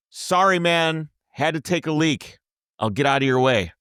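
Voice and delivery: loud, high-pitched, held-back apology; forced politeness. Retold or imitated. Traveller apologizes to angry driver after a breath, forced politeness. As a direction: call out an apology in place of swearing. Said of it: "I take a breath, and instead of saying, "F you," I go".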